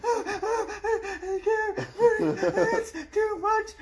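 very high, loud, drawn-out wailing; badly overdone sobbing wail, gasped out slowly; mock hysterical crying. Mocking a moviegoer crying at Endgame, hammed-up hysterics. Mimics woman hospitalized for crying.

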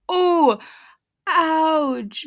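loud, high pained reaction; sympathetic wince. Real, not acted. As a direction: cry out as if you felt the injury yourself. Winces at a listener's injury story.

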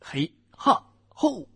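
karate yell. Acted. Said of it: "大吼一声".

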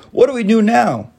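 high, loud panicky cry; a frightened worm's shrill plea; panicked earthworm. A narrator's reading. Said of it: "shrieked the earthworm".

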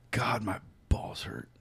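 slow, soft, breath-only groaned words; pained near-whisper. Retold or imitated. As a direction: murmur it slowly through the ache. Retold pain on getting home.

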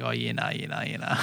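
broad Australian accent. Shown as a demonstration. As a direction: lay on a thick Australian accent, low and soft. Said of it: "use your Australian accent or say something Australian".

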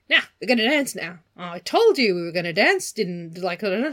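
hammed-up Australian brushing off the question; quick cheeky Australian lad; mock Australian accent. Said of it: "they've suddenly both become Australian".

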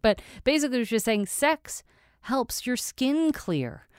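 ordinary delivery

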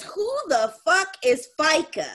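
high-pitched indignant outburst; mock outrage. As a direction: imitate an outraged daughter seeing the paycheck. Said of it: "mad as hell".

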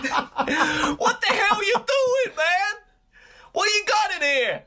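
high, drawn-out outraged yell; mock outraged rant. Friend doing a TV bar rescuer tearing into a pub, mock outrage. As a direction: bellow it in high, exaggerated disbelief. Impersonating John Taffer shouting at bar staff.

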